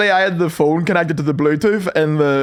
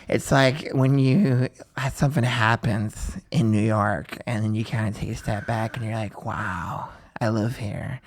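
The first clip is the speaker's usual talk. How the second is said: mock awestruck musing; 4 semitones lower, 6 dB quieter than usual. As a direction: imitate someone lost in quiet wonder. New Yorkers' dreamy moment, mimicked.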